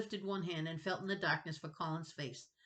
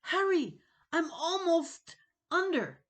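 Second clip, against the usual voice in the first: high, loud panicked cry for help; trapped boy screaming for rescue; 12 semitones higher, 8 dB louder than usual. Acted. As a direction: plead like water's at your chin. Drowning boy calls for help.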